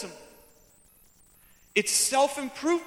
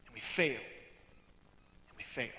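low, soft, sinking repetition; deflated admission; 10 semitones lower, 11 dB quieter than usual. Acted. Preacher admitting shared failure after vows, low and deflated. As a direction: let it fade almost to nothing. Resolve collapses into repeated failure.

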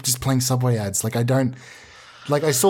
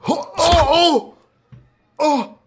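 pained yelp; 11 semitones higher, slower than usual. Acted. Punched by the visitor, yelping in pain. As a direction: cry out after a punch.

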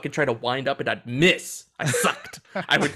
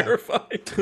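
ordinary talk

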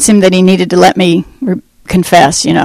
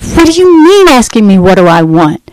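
retold scream; 4 semitones higher than usual. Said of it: "And I screamed at him".

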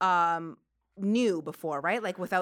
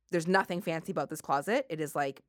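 ordinary talk, nothing marked

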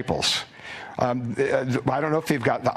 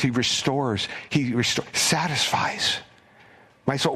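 psalm recital; 2 semitones higher than usual. Acted. Preacher reciting Psalm twenty-three to the congregation, reverent. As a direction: savor each line of the shepherd psalm.

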